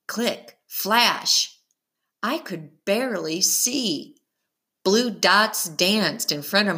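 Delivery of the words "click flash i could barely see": ordinary delivery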